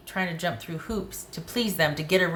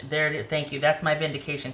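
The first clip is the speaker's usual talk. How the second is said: usual speaking voice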